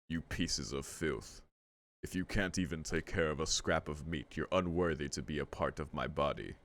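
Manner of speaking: quiet menacing villain voice; deep villain. Acted. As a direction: sneer it down low like a monster in a coat. Villain line after deep voice.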